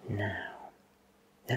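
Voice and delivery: ordinary talk, nothing marked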